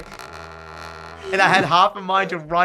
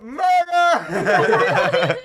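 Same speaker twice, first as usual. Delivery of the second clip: hammed-up cry; 7 semitones higher than usual. Joking about revenge.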